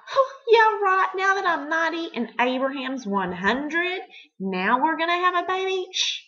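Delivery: sarcastic inner scoff. Acted. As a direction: scoff at the idea like it's a joke. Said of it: "Sarah laughed".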